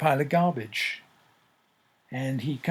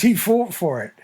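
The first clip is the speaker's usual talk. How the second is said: higher and louder, a defiant answer; defiant retort; 5 semitones higher, 6 dB louder than usual. Retold or imitated. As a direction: throw it back at him, louder. Landowner's final claim.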